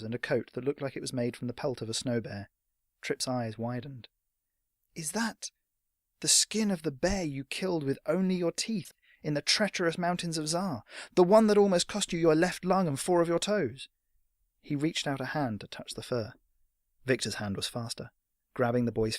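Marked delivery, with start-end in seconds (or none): 4.9-13.9 a higher, breathless eager lad; lightly voiced starstruck boy; awed boy. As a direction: gush with hero worship. Trip awed by coat.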